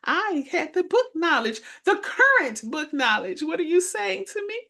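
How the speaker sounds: high, offended disbelief; young teacher stunned by the veteran's advice; indignant surprise. Retold or imitated. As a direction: act shocked and insulted. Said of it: "I said".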